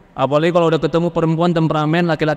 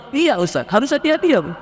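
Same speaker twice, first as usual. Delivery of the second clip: indignant congregant; 8 semitones higher than usual. Retold or imitated. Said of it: "acted congregant reply".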